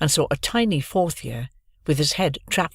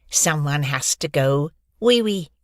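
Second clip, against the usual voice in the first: mocking bully; slower than usual.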